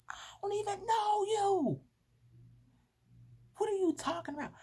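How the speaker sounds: incredulous high-pitched screech; outraged disbelief. Retold or imitated. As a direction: squeal it in stunned offence. Acted outraged reply to accuser.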